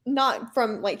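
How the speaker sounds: normal talking voice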